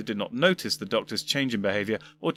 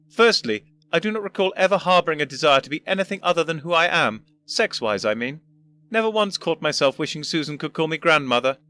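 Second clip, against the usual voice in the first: higher, louder, superior lecturing; unimpressed old doctor; 5 semitones higher, 4 dB louder than usual. Acted. First doctor lecturing his future self, unimpressed. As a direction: count the reasons off with disdain. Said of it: "considerably unimpressed".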